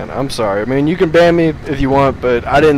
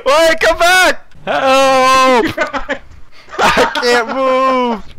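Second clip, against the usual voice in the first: mock helpless cries; 9 semitones higher, much slower than usual. Voicing a stuck game cat.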